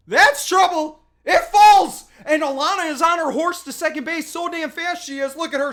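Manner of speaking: high, loud, thrilled call; excited yelling. Real, not acted. Commentator cheering the ball dropping in, loud and excited. Ball drops for extra bases.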